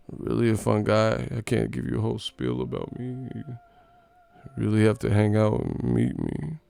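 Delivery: lightly voiced goofy interview tone; understated parody voice; mock imitation. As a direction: mimic the player's sleepy interview manner. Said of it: "What was that laugh that he did?".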